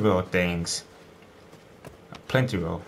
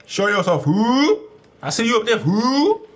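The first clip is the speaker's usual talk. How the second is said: taunting call; 11 semitones higher, 4 dB louder than usual. Real, not acted. Taunting a hidden enemy above.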